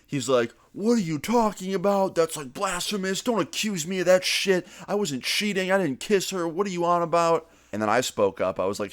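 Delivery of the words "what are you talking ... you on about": imitating an outraged denial, pitched much higher; losing his shit. Cheating dad denying it to nosy kids at a diner, higher indignant outburst. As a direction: play the caught dad blowing up, voice jumping high. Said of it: "Seth's dad immediately loses his shit".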